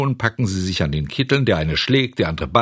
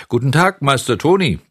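polite greeting; 7 semitones higher, 5 dB louder than usual. Acted. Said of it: "grüßte Seppel artig".

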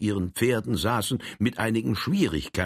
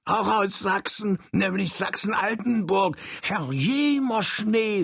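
Saxon dialect; 12 semitones higher than usual. Acted. Little Saxon falls into his home dialect, high Saxon. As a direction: slip into broad Saxon, high. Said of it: "in seinen heimischen Dialekte ein".

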